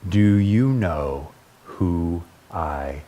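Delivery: low, very slow and weighty; solemn story reading. A narrator's reading. Preacher reading a deeply spiritual picture book, solemn and slow. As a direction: read it with long, reverent pauses.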